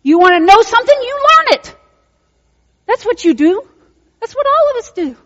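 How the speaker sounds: high, loud preaching climax; preaching at full force. Real, not acted. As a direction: drive it home like a revival preacher. Preacher repeats point at full force.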